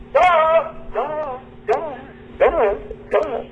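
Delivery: high, fading echo sounds; imitating an echo. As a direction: call out and let it echo. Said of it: "Echoed right back".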